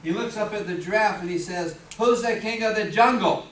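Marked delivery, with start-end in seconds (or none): 2.0-3.5 loud, boastful demand as a cocky lion. Acted. Lion challenging the giraffe, boastful and loud. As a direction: puff up and ring the question out loudly.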